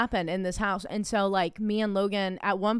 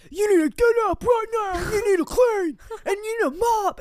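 very high, loud shouted orders; mocking bossy husband; 14 semitones higher, 7 dB louder than usual. Husband ordering wife to clean, mocking shouted imitation. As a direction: bark out chores in a mock-husband yell.

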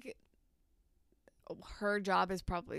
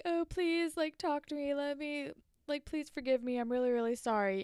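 mock pleading; 7 semitones higher than usual. Spinner begging Jane, acted.

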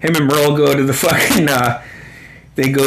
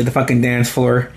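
ordinary talk, nothing marked

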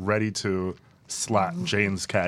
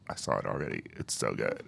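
low, hushed retelling of a friend; understated low murmur as the friend; imitated friend; 14 semitones lower, 11 dB quieter than usual. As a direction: drop way down, much softer, to quote a friend. Imitating friend's verdict on the film.

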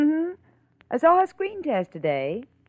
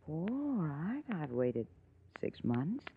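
soft, low, menacing murmur; murmured low and soft, a man alone with a grudge; quiet resolve; 5 semitones lower, 10 dB quieter than usual. Acted. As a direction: say it under your breath, alone.

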